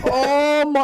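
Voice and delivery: high, loud gasp of pain; high pained exclamation; pained cry. Retold or imitated. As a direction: cry out as it rips. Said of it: "I was doing".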